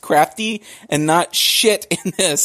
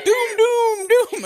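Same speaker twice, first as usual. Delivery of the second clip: very high sing-song doom chant; fish going doom; much slower than usual. Retold or imitated. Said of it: "The fish has been going".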